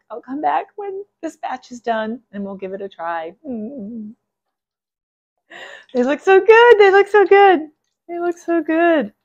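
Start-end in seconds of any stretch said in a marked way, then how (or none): 5.9-9.1 much higher and louder, thrilled; excited delight. Real, not acted. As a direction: gush over how good they look. Tasting the fried squash balls.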